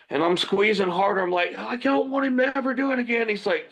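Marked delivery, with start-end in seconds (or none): 1.6-3.3 high, tight retold strain; straining with effort. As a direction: force it out mid-squeeze. Acting himself squeezing the chokehold harder.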